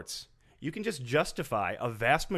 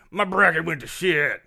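loud mock complaint; hammed-up griping coworker; complaining office whiner; 3 semitones higher, 7 dB louder than usual. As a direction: whine about it to the whole office. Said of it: "Will you complain to everyone".

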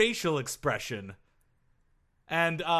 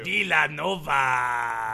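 long drawn-out scratchy Villanova; slow scratchy shout; 4 semitones lower, much slower than usual. Shown as a demonstration. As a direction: drag the name out in a scratchy holler. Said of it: "doing a scratchy voice".

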